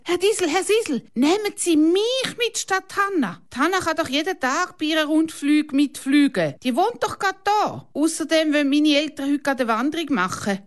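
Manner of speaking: put-on high, eager child voice begging; pleading boy. A narrator's reading. Boy begs the pilot to take him along, high and eager. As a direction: beg the pilot like a pushy kid. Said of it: "boy Kalle pleading with pilot".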